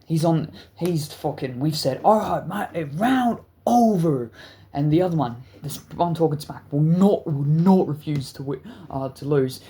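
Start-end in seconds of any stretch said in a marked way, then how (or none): 2.0-4.3 raised, slow, drawn-out call; referee's call. Retold or imitated. Friends stopping the fight club round, calling out.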